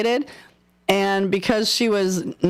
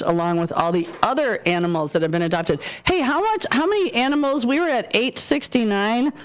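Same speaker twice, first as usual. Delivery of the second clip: ordinary delivery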